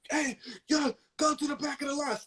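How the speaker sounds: high, quick mock yell; yelling drunk man. Drunk man sending someone to the back, mock yelling. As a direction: imitate a drunk hollering, without full volume. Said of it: "So he's yelling at me".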